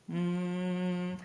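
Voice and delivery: steady hum through the nose; humming. Shown as a demonstration. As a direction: hum with lips closed, like a monk. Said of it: "then you exhale and you hum".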